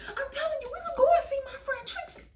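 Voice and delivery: very high, squealed protest; hammed-up high-pitched insisting; mock insistence. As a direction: squeal it like a liar doubling down. Acting friend insisting on lie.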